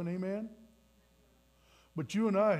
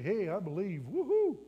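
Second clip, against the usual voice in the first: mock celebration; 2 semitones higher than usual. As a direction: toss it off, then whoop in mock glee. Mocking a casual profession of faith.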